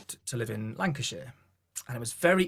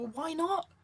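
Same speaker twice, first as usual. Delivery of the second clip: puzzled challenge. Retold or imitated. Acting out challenge to reluctant singers.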